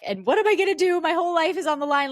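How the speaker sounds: high, loud distressed wail; panicked wailing. Retold or imitated. Wailing to mom on the phone in panic. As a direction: cry out in full panic.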